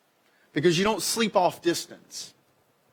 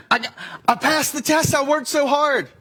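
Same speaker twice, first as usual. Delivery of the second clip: put-on much higher, louder, proud child; excited kid; 11 semitones higher, 4 dB louder than usual. Kid home from school, proudly telling a parent. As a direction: beam with pride about the test. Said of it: "your kid comes home".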